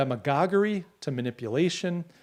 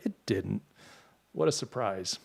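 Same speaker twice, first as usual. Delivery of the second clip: low, quieter dry aside; a little bitter; 4 semitones lower, 5 dB quieter, slower than usual. Real, not acted. Host recalling a hyped camera that flopped, a little bitter. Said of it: "little bitter today, aren't I".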